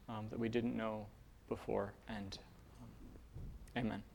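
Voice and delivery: soft spoken prayer, fading to almost nothing by the amen; quiet prayer. Real, not acted. Pastor praying with the congregation before the sermon, soft and hushed. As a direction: pray gently and let the voice drop away.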